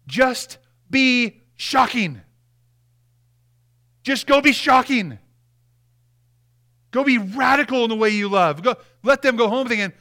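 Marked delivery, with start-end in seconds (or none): 0.1-8.6 higher and louder, stretched out; charging the congregation. Real, not acted. Preacher charging his listeners to be shocking, loud and high. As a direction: hurl the charge at them. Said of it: "Here's my charge to you".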